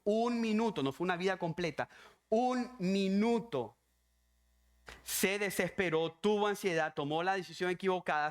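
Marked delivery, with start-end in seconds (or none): 0.0-0.8 "un minuto" pitched higher, punched out; emphatic repetition. Real, not acted. Preacher stresses how brief the lapse was, emphatic "un minuto". Repeats the key phrase for emphasis. 2.3-3.8 "un minuto" at half speed, each word separate; slow, hammered emphasis. Real, not acted. Preacher stresses it was no lifetime, slow "un minuto".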